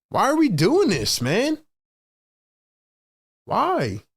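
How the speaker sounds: high, exasperated complaining, rising with each line; frustrated rant. Real, not acted. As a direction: vent at the team, pitch climbing. Fan rants at team's iso offense.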